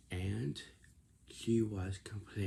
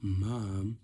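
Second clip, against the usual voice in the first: complaining; 7 dB louder than usual. Retold or imitated. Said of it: "And then my aunt says".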